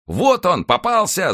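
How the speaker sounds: high-pitched cry of attack; excited cry. A narrator's reading. Horsemen rushing at d'Artagnan with bared swords, excited cry. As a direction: call it out high, like riders spotting their quarry. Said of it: "закричали всадники".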